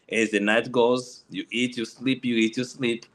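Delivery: ordinary delivery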